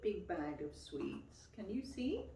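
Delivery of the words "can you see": plain reading voice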